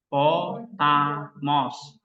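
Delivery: Potamos drawn out slowly, syllable by syllable; slow syllable demo. Shown as a demonstration. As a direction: stretch out Potamos so each syllable is heard. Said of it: "Berarti berapa suku kata?".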